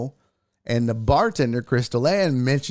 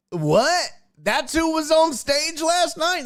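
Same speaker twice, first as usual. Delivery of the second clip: shocked realization; 12 semitones higher than usual. Retold or imitated. Drunk fan realizes who it was.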